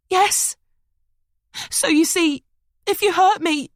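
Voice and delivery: begging him, pitched high with fear; frightened woman pleading. Acted. Rebecca begs Liam not to hurt her, very high.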